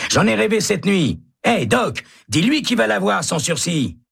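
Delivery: high insistent reassurance; hopeful. A narrator's reading. Hennessy reassuring Baca, high and eager. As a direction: convince him it will come.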